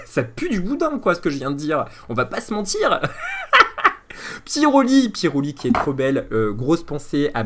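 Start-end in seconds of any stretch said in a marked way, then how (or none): 3.0-4.4 a burst of very high laughter; high squeaky laugh. Real, not acted. Laughing at his own bad pun, high squeaky laugh.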